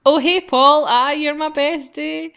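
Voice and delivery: bright, loud, grinning hello to a friend; cheerful greeting. Acted. Player voicing the hero greeting his friend Paul, grinning loud. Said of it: "I look up from the course catalogue and grin".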